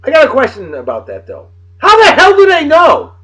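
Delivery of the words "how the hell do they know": indignant outburst. Real, not acted. Indignant rant at history fact.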